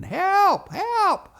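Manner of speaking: very high, loud cries for help; acted screaming. Boys shut in the well house, screaming for help. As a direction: scream it like a frightened little boy. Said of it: "we started screaming".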